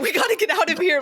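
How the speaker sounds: high, mock-urgent; urgent escape line. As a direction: push to leave right now. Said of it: "You'd be like".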